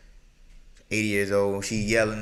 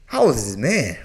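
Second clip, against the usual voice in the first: shocked outburst; 2 semitones higher, 12 dB louder, slower than usual. Real, not acted. Shocked at eighty-year-old.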